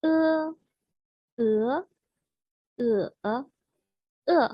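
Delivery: "E" level, rising, dipping, then falling; four tones in turn. Shown as a demonstration. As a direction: Mandarin tone drill on "E". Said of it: "directement les quatre tons".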